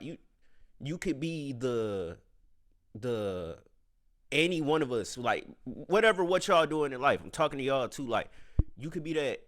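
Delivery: ordinary delivery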